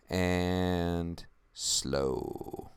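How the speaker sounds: drawn-out slow. Shown as a demonstration. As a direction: stretch the words out long and low. Voice mirrors the slowed-down clip.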